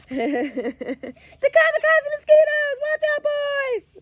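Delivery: high, slow shriek through laughter; joking made-up warning; mock alarmed mother. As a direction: wail it like a panicked mother while giggling. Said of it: "Okay, sorry".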